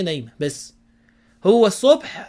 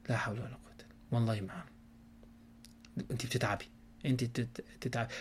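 fast breathy whisper of sympathy; hushed sympathy; 6 semitones lower, 15 dB quieter, faster than usual. Shown as a demonstration. Husband whispering pious sympathy to his wife. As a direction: whisper it quickly as a caring husband. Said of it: "لا، نوع بقى".

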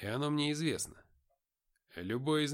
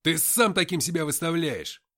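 loud hard bark, stress on сам; barked retort; 4 semitones higher, 9 dB louder than usual. A narrator's reading. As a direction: snap сам таким back at him. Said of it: "рявкнул я в ответ".

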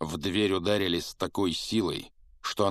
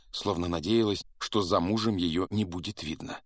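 ordinary delivery, nothing marked

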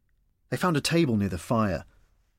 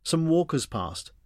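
ordinary delivery

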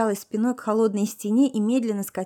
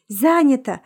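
sharp loud call through the door; exclaiming; 4 semitones higher, 7 dB louder than usual. A narrator's reading. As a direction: shout it at the door. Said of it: "воскликнула девушка".

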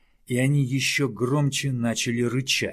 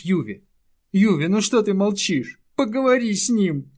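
pleading; 9 semitones higher, 6 dB louder than usual. Acted. Small creature begs for help.

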